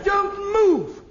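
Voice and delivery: high, loud cry to freeze; urgent excited command. Acted. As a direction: stop them dead with a loud, high order. Director stops everyone on hearing voice.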